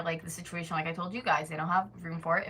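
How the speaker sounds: normal talking voice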